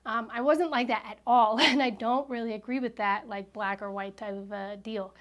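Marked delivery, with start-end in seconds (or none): none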